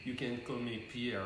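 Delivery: soft, low French lover voice; sexy French accent. Pretend. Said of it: "speaking in this very sexy French voice".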